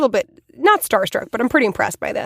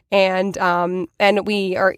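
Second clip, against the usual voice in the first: plain reading voice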